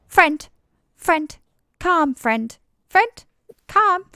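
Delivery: high, loud, squeaky failed whisper; a creature trying to whisper, badly. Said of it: "trying his best to, like, whisper, but Chitter's not that great at whispering".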